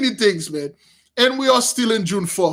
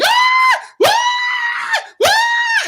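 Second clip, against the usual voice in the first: high-pitched shrieks, very high; mock panicked high screams; imitated women screaming; much slower than usual. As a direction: shriek like frightened women in a tank.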